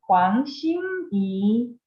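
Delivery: Huang Xinyi said slowly in careful Mandarin; slow Mandarin name. Shown as a demonstration. Teacher to class: slow model of own name. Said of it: "And my name is".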